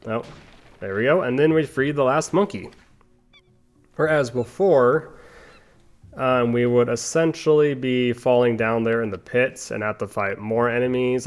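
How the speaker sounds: ordinary talk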